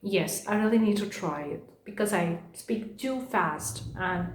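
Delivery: slow down on purpose, soft and spaced out; speaking slowly. Real, not acted. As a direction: rein in a fast talker's pace, gently. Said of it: "I'm gonna try to speak slowly".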